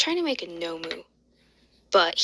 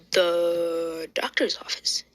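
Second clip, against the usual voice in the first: drawn out; 2 semitones lower, slower than usual. Acted. Drawn-out scene change.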